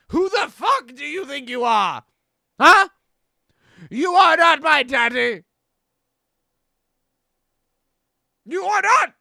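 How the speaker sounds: high, loud enraged yell; furious shout. Acted. Chef at the customer who called him boy, screaming. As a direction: scream down the insult.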